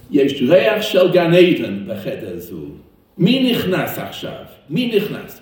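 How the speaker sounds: loud, high, drawn-out call; amazed exclamation. Retold or imitated. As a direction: call out in wonder as the rabbi. Acts rabbi exclaiming as visitors enter.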